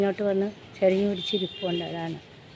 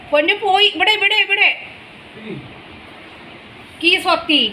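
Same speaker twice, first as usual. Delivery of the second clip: cooing; 9 semitones higher than usual. Real, not acted. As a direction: coo at the little darling to come here. Cooing at the little one.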